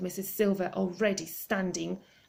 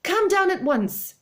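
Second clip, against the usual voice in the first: eager call; 11 semitones higher, 7 dB louder than usual. Acted. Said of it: "Missus Silver answered".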